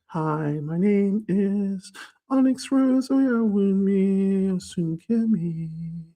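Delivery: high, slow, drawn-out voice; put-on high voice. Shown as a demonstration. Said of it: "in my voice like this".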